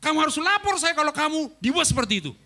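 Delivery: angry senior pastor scolding his protégé; angry rebuke. Retold or imitated. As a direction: lay down the law in anger. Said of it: "Pak Roni marah besar".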